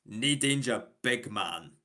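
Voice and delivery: a casual Scottish "nay danger, big man"; a light, easy Scottish accent. Retold or imitated. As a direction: brush off thanks like a Scot. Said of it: "and they'd say".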